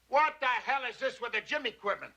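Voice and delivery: high-pitched, exasperated demand; irritated aside. Acted. Studio man berates staff mid-call, exasperated. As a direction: break off to complain sharply.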